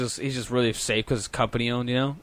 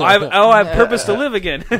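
high, loud burst breaking into laughter; hammed-up sudden joy, laughing through it; mock revived excitement; 6 semitones higher, 8 dB louder than usual. Gamer answering his friends' call, comically overjoyed.

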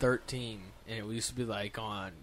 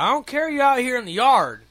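put-on loud, higher old man yelling at the kids; old neighbor shouting down kids on quads, loud and high; cranky old neighbor; 11 semitones higher, 11 dB louder than usual. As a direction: bark it like a cranky neighbor chasing kids off his road. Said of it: "He's like".

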